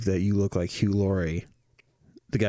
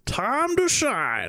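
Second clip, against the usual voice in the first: high, slow creature voice; drawn-out gleeful creature squawk; Smeagol; 11 semitones higher, much slower than usual. Acted. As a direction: stretch it in a creepy high croon. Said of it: "when people heard Smeagol".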